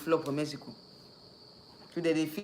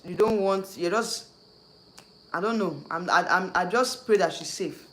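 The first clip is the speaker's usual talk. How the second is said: ordinary talk, nothing marked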